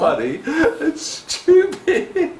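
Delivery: laughing slowly through scorn; mock him, laughing as you speak. Peacock laughing at crow.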